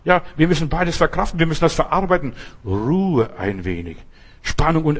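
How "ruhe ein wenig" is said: soothing refrain. Acted.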